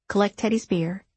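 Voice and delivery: usual speaking voice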